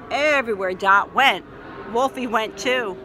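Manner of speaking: ordinary delivery, nothing marked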